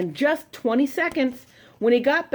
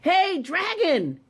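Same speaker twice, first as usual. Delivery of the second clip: higher, slow "Hey, dragon!"; shouting; 8 semitones higher, slower than usual. Acted. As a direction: call into the sleeping dragon's ear. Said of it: "She shouted as loud as she could".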